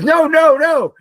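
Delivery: high loud no no no; girl shouting no in dismay; Lisa crying no. Retold or imitated. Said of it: "And Lisa goes".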